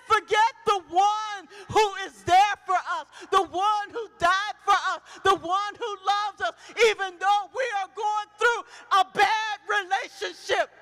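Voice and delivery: loud, high, ringing preacher's cry; shouting sermon voice near tears; full-force preaching. Acted. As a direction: preach it out to the whole church.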